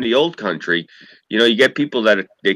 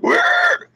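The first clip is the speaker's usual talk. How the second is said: pig squeal imitation. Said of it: "you hear, like".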